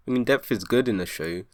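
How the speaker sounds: usual speaking voice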